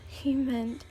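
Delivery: usual speaking voice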